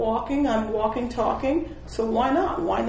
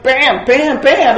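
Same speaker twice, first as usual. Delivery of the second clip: much louder punches of "bam"; growing loud excitement; 4 semitones higher, 10 dB louder, much slower than usual. Real, not acted. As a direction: hit each bam harder.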